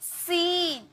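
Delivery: सीन said slowly with a stretched "seeen"; long ee. Shown as a demonstration. Teacher shows students the stretched vowel in सीन. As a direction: pull out the ee in सीन. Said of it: "टान कर लिया जाता है".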